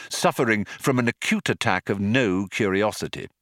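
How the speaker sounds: ordinary delivery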